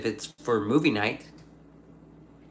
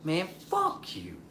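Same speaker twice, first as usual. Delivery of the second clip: softer, slow muttered insult; a quieter, slow mock brush-off; quiet slow mock curse; 4 semitones higher, 11 dB quieter, slower than usual. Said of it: "I was like".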